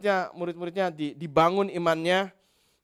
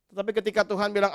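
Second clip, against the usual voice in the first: normal talking voice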